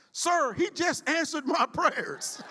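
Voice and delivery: high slow recruit line with a laugh; amused retold reply. Recruit to instructor, high slow line with a laugh. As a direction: deliver the punchline laughing. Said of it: "And she replied".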